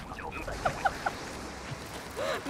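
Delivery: high, breathless squeaky giggle; wheezy high laugh. Real, not acted. Laughing at own joke.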